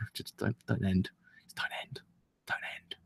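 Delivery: fading to a breathless whisper; whispered plea. Retold or imitated. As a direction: beg softly as it slips away. Begging series not to end.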